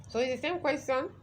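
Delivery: usual speaking voice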